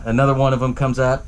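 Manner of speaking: usual speaking voice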